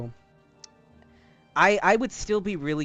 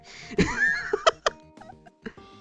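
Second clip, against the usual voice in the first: very high burst of laughter; 6 dB louder than usual. Real, not acted.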